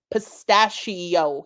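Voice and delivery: Pistachio drawn out slowly as a lesson; slow repetition. Acted. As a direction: repeat Pistachio slowly for a child. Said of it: "he repeated".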